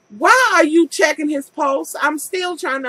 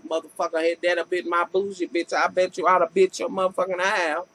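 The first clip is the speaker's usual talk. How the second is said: lower, muttered mock threat to the neighbor; inner threat; 8 semitones lower, 3 dB quieter, faster than usual. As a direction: growl it under your breath at the neighbor. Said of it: "I'm looking like".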